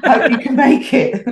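cheeky giggle at own joke; laughing through it. Retold or imitated. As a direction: let the laugh take over the last line. Joke PS on proposal card.

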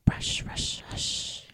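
soft hushed whisper. Acted. Reading about wind in trees, whispering. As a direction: breathe it out like wind.

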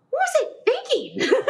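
high, laughing self-mockery; incredulous squeal breaking into laughter; laughing disbelief. Real, not acted. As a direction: laugh at your own foolishness, pitch way up. Laughing at herself, looking back.

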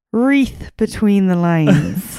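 slow, loud segment title; grand podcast segment announcement; announcer. Acted. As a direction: announce the segment like a show host. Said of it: "little segment called".

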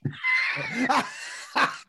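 high shrieking laugh; big laugh. Real, not acted. After telling the joke.

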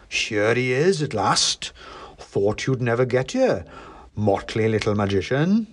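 lightly voiced Santa with muted cheer; understated, faintly ominous Christmas patriarch; not-quite-jolly Father Christmas. A narrator's reading. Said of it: "Father Christmas in a way".

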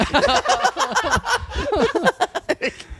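high, long burst of laughter; shrieking laugh. Real, not acted. Huge laugh.

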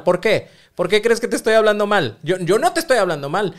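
loud, high defensive snap; acted partner snapping back, loud and high; defensive retort. Acted defensive reply in quarrel.

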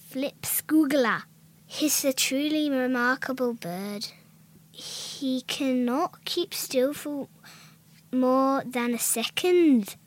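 slow, deliberate Scottish poem reading; amateur Scottish, gaining confidence; Scottish accent attempt. Shown as a demonstration. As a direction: recite the rhyme slowly in put-on Scottish. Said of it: "say that in a Scottish accent".

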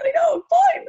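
teary denial. Retold or imitated. New mum tells her mother she's fine, voice cracking high. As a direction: insist you're fine while about to cry. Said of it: "I was crying".

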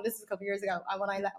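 ordinary delivery

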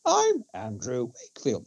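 lightly voiced smooth doctor introducing himself; Wakefield impression. Mocking how the struck-off doctor introduces himself, lightly imitated. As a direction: imitate a self-important doctor, understated. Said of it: "That's, that's how he talks".

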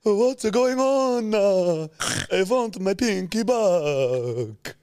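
high, loud, drawn-out vampire wail; mocking Dracula. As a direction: mimic your friend as a wailing Dracula, very slowly. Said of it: "turned into a fucking Dracula".

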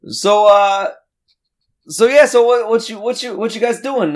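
high, eager, a touch sleazy stranger; pushy guy voice. Acted. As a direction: come on too strong, chummy.

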